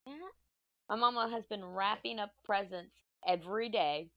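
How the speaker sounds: ordinary talk, nothing marked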